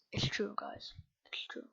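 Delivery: whispered, as if choked up; hushed, pretend emotional whisper; mock tearful whisper. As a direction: whisper it like a fake confession. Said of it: "I don't know why I'm crying".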